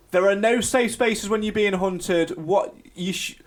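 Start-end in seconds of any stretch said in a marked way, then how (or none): none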